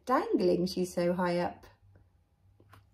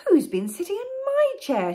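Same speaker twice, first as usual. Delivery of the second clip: higher, loud mother bear voice; medium-sized Mother Bear; 13 semitones higher, 7 dB louder than usual. Acted. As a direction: ask indignantly as the mother bear, pitched up. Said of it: "in a medium-sized voice".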